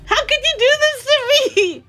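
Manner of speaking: mock fury. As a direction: yell at her in joking betrayal. Mock betrayal at friend.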